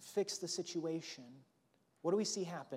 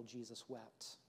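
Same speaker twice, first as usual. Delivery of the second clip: hushed reverence; 5 semitones lower, 13 dB quieter, slower than usual. Shown as a demonstration. Pastor to congregation, hushed and reverent. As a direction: drop the voice to a near hush on Jesus wept. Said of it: "yet arguably one of the most powerful".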